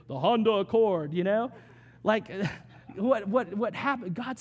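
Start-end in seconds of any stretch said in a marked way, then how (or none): 0.1-1.1 mock thunderous God. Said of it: "this loud, thunderous voice".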